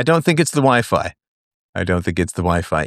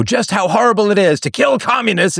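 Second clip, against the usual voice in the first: mock horror; 11 semitones higher than usual. Mocking the message of war movies.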